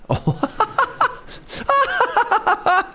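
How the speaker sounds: long real squeaky laugh; high squealing laugh. Real, not acted. Player laughing at his ruined docks, squealing laugh. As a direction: let a high laugh run on and on.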